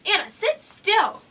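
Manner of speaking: loud, slow scolding call to Anna; sharp mom call. A narrator's reading. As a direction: bark it at the little sister, slowly. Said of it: "mom scolding".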